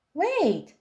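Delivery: high call; girl pirate crying out; cried out. A narrator's reading. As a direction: stop them just in time. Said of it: "she cried".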